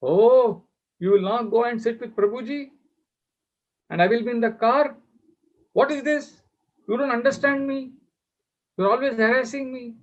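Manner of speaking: high-pitched mock shouting at a husband; imitated scolding wife. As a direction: play an indignant wife telling him off, pitched well up. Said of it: "she suddenly started shouting at him".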